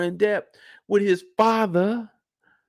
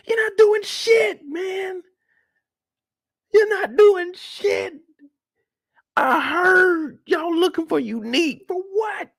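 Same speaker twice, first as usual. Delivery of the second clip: high, drawn-out crying protest; flipping out. Acted. Kanan arguing back at his mother, high whining outburst. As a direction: throw a wailing teenage tantrum. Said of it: "Kanan is just flipping out".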